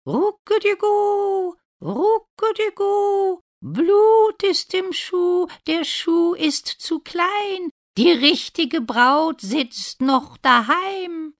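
very high, slow, loud cooing chant; calling doves. A narrator's reading. Doves warn the prince of blood in the shoe, calling high. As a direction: call out like doves, stretching every vowel. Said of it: "rufen die Tauben wieder".

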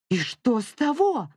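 high, exasperated yell; furious mother cutting off her child; angry shout. A narrator's reading. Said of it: "крикнула Летиция".

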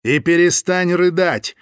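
slow, cold, hard command; stony voice. A narrator's reading. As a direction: order it like stone. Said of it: "каменным голосом продолжил он".

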